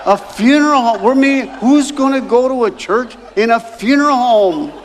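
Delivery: can't believe it, half laughing; shocked disbelief. Retold or imitated. Shocked at funeral home church.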